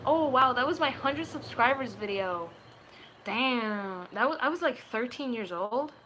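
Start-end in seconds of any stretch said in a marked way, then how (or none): none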